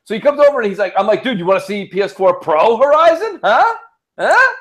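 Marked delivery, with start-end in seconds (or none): 1.2-4.5 higher, louder eager showing off; hyped-up teasing. Retold or imitated. To his friend, eagerly showing off the console. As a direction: show it off to a buddy, pumped.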